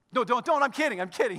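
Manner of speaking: loud, hurried call-off; hasty backtracking. Real, not acted. Pastor stopping a listener who starts obeying, hastily backtracking. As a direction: quickly take back a joke order. Calls off his mock order.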